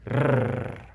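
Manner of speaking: long rolled Spanish r; demonstrated alveolar trill; sustained tongue trill. Shown as a demonstration. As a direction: let the tongue vibrate on a held "rrr". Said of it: "your tongue starts to trill".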